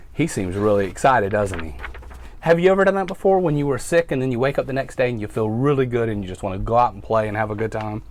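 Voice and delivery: ordinary talk, nothing marked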